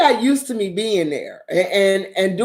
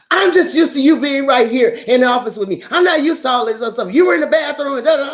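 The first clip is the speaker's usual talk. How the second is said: higher, fast, tearful-sounding imitation of him; upset coworker; 7 semitones higher, faster than usual. Attorney explaining to Pat why he is upset, higher tearful imitation. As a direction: voice the attorney close to tears.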